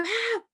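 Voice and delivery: sudden high mock shriek of an upset child; child's frustrated shriek. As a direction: shriek it like a toddler who doesn't get his way. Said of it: "And he's like".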